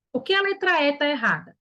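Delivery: ordinary delivery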